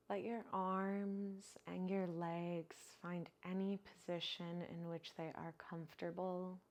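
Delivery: unhurried, even-toned guide into relaxation; guided meditation. Acted. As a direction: ease them into stillness, drawing out the phrase endings. Leads breathing and body relaxation.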